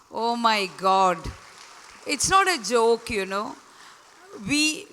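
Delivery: usual speaking voice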